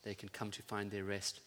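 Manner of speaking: soft, unhurried prayer voice; pastoral prayer. Acted. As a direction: pray gently over the people. Pastor prays for congregation.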